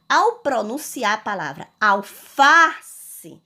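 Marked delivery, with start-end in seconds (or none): 1.8-3.4 "alface" slowed, with a strong long "fa"; middle syllable stressed. Shown as a demonstration. Teacher pronouncing the word to show stress, "alface" with "fa" emphasized. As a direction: lean hard on "fa" in "alface". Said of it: "uma sílaba mais forte".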